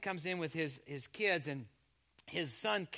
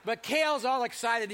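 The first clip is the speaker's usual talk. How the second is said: usual speaking voice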